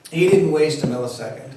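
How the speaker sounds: plain reading voice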